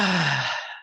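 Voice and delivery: long audible sigh out; exhaled sigh. Shown as a demonstration. Teacher showing how to exhale any tension, releasing sigh. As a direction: let the tension go in one big falling sigh. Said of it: "exhale a sigh or yawn".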